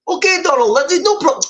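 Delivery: a hammed-up, failed attempt that slides into a Russian accent; Putin-style Russian accent. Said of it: "That's Putin, isn't it?".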